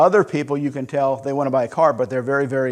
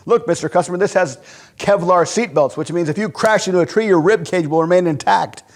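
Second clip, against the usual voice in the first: a slightly higher, pushy showroom patter; eager salesman pitch; 4 semitones higher, 4 dB louder than usual. Retold or imitated. Salesman pitching seatbelts to a customer, eager patter. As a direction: sell it like a car salesman.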